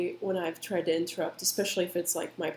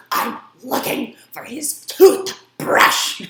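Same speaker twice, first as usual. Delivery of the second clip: slow, loud, higher, biting each word; venomous snap; 6 semitones higher, 11 dB louder, much slower than usual. Retold or imitated. Mom snapping back at the dad during a fight. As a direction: spit it out with venom. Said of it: "she said it with such, like, venom".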